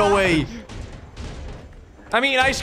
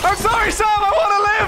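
panicked laughing shout; 10 semitones higher than usual. Real, not acted. Abandoning teammate to zombies.

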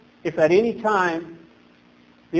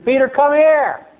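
Jesus calling Peter, raised voice; 7 semitones higher, 3 dB louder than usual. Acted. Jesus calling Peter to come out onto the water, calling out. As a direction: call out higher across the water.

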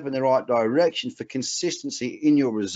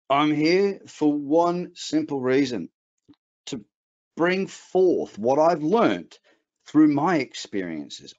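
slow and deliberate; 3 semitones higher, slower than usual. Real, not acted. Earnest mission statement to audience.

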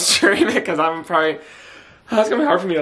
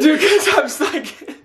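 high, slow, helpless laughter through the words; laughing; 9 semitones higher than usual. Real, not acted. Stunned listener answering the songwriter, laughing. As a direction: laugh while trying to explain yourself. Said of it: "You're, like, laughing".

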